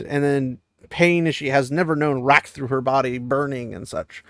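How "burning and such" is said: normal talking voice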